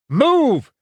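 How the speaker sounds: high, loud yell over a racket; urgent battlefield yell. A narrator's reading. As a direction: yell it out above the noise. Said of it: "Winters yelled above the racket".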